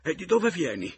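high, loud, frightened question; terrified woman's cry; terror. A narrator's reading. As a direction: ask it in sheer fright. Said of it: "mi chiese con terrore".

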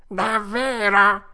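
loud, high, drawn-out cat wail; echoing cat. A narrator's reading. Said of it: "ripeté il gatto". (gatto che fa eco, forte e lamentoso)

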